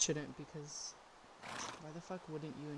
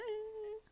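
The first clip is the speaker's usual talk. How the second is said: short high laugh. Real, not acted. Laughing while eating on stream.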